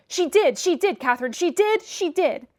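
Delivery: put-on high, loud childish chant; singsong taunt. A narrator's reading. Linton taunting Catherine in a high singsong. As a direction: gloat in a sing-song.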